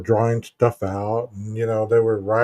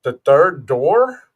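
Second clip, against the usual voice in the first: very slow questioning repeat; acted slow, baffled check; puzzled echo; much slower than usual. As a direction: sound it out slowly as a question. Acts out puzzled repeating back.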